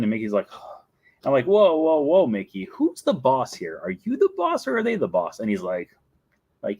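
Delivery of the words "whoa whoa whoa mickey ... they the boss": higher, teasing protest; mock challenge. Guest challenging Mickey on who is boss, mock indignant. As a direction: egg him on with mock indignation.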